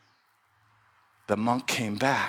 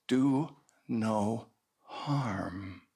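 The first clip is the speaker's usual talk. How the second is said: slow, soft, weighty pronouncement; solemn slow; 3 semitones lower, 5 dB quieter, much slower than usual. Acted. Monk softly giving the snake the sacred truth. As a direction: hand down a sacred truth gently.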